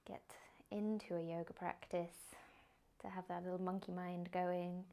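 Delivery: soft, unhurried meditation guidance; guided meditation. Acted. Yoga teacher guiding the class into a body scan, soft meditation voice. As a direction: ease them into stillness gently.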